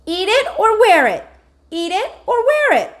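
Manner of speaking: put-on a small boy's furious chant; toddler screaming. A narrator's reading. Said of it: "Fudge screamed".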